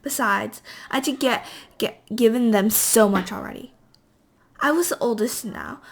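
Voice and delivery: ordinary delivery, nothing marked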